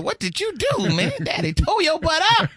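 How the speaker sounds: put-on high, loud kid taunt; mocking kid voice. Kid teasing his brother about a whooping. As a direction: tease a sibling in a squeaky kid voice.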